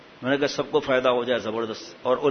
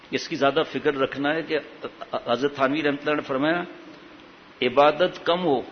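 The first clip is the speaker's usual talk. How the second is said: normal talking voice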